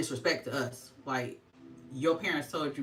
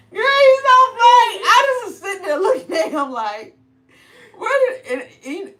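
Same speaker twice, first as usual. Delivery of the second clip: much higher and louder, laughing through the words; laughing hard; 14 dB louder than usual. Real, not acted. Mom telling viewers about her son, laughing hard. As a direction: talk while still cracking up. Laughing retelling son's prank.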